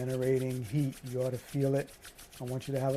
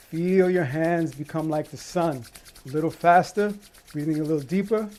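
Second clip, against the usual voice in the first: energized coaching; 3 semitones higher, 10 dB louder than usual. Real, not acted. Energizing coach pushing harder.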